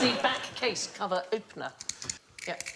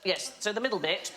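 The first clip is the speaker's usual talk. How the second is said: ordinary delivery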